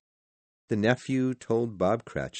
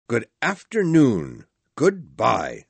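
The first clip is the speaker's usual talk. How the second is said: loud, higher angry send-off; curt dismissal; 3 semitones higher, 8 dB louder than usual. A narrator's reading. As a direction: dismiss him loudly. Scrooge dismisses his nephew.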